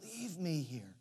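soft, broken little plea, fading away; a hurt child begging to be left; a daughter hiding in the leaves, quietly pleading to be left; quiet tearful child. Retold or imitated. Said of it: "she said".

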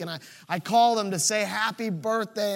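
usual speaking voice

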